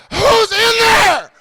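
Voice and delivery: high, slow drawn-out call; echoing call. Acted. Worried comic calls inside, long echoing holler. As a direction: holler it high and stretched into a cavern. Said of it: "First pussy echo".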